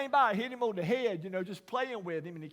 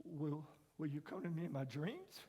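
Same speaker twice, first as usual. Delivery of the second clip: put-on much softer, hushed echo of the boy's question; soft repeat; 3 semitones lower, 10 dB quieter than usual. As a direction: repeat it almost under the breath. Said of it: "he said those eight words".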